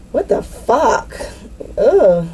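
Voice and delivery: slow, high, drawn-out what the fuck; shocked disbelief. Real, not acted. Reacting to the reading, slow high stunned disbelief.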